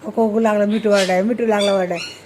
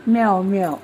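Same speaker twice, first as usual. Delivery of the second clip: lower-pitched imitated meowing; cat meow; 5 semitones lower than usual. Shown as a demonstration. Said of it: "म्याव कशी ओरडती".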